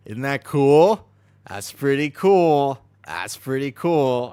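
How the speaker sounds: loud, very slow, drawn-out "cool"; booming, lingering mock awe; silly show-off voice. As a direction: boast about it in a stretched goofy voice. Showing off new lights, silly voice.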